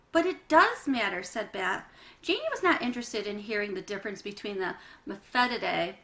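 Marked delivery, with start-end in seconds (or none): 0.1-1.2 protest. Acted. Bat protesting.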